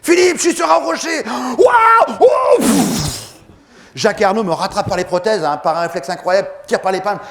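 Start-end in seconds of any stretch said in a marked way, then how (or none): 0.0-3.2 very high, loud panicked shouts; shouting on the rock. Retold or imitated. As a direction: yell through the crashing wave. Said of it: "cri retransmis en accéléré". (cris aigus et forts sous la vague)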